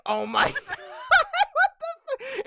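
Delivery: high, slow laughing disbelief; shocked laughing. Retold or imitated. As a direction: gasp it out through laughter. Retelling own shocked reaction.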